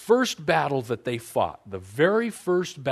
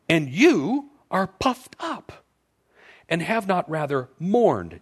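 scripture reading; 4 semitones higher, 5 dB louder than usual. Acted. Reads scripture aloud.

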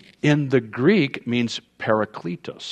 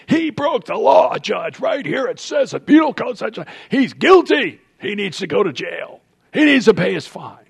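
high, loud, insistent bailiff pleading to the judge; accusing bailiff; 8 semitones higher, 4 dB louder than usual. Retold or imitated. Bailiff pressing the judge to jail the son, high and loud. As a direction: push the charge hard, voice up high.

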